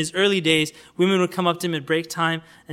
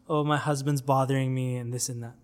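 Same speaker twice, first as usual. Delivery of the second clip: quiet, slightly lowered complaint; lightly voiced complaining wife; 5 semitones lower, 7 dB quieter than usual. Wife complaining to the imam about her husband, lightly voiced complaint. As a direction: grumble it softly, only lightly acted.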